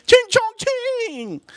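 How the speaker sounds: very high, drawn-out mocking screech; caricatured high-pitched calling out; mock scream. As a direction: stretch the last syllable in a high mock yell. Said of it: "she screams at her other friend".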